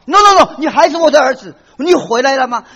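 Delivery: loud, high "No, no, no", insisting he is still his son; father's loud protest. Acted. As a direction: burst out over the son's words, a father refusing to let go. Father refuses son's plea. (父亲激动地高声打断)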